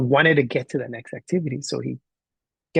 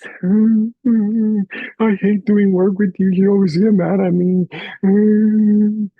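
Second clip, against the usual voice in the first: loud, high wail, words drawn out; mock-bawl like an upset little kid; imitated crying child; 7 semitones higher, 6 dB louder, much slower than usual. Crying boy complaining to his teacher, loud imitated wailing. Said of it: "He was crying".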